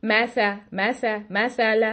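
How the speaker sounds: "मैसा" and "मैसाला" with the vowel flattened toward "ऐ"; mock the mistake, "मैसाला" instead of "मासाला"; wrong spread-lip fatha. Shown as a demonstration. Teacher mimicking the students' spread-lip error in a word. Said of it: "This is not acceptable".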